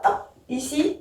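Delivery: ordinary talk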